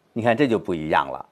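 ordinary talk, nothing marked

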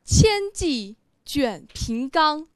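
loud, proud declamation; 得意的朗诵. Shown as a demonstration. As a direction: recite boldly and pleased with yourself, projecting each phrase. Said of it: "你是不是很得意呀". (得意、豪放地大声朗诵)

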